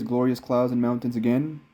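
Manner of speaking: ordinary talk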